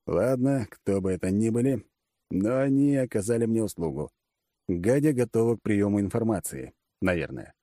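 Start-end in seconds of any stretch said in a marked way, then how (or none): none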